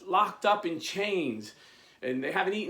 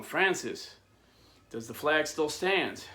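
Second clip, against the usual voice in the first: ordinary delivery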